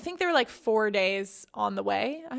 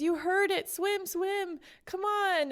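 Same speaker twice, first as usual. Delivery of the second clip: calling the tiger to swim, higher and urgent; 8 semitones higher, slower than usual. A narrator's reading. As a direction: pitch it up and urge him on. Pie urging the tiger to swim.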